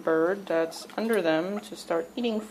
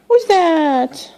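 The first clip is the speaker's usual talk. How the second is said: high, loud, excited call to the bird; 8 semitones higher, 13 dB louder than usual. Real, not acted. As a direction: call out brightly to the pet. Calling out to a bird.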